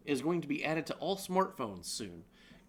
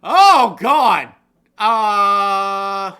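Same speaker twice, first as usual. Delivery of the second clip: loud startled cry; shocked outburst; 4 semitones higher, 17 dB louder, much slower than usual. Real, not acted. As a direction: blurt it out in shock. Shocked at the idea.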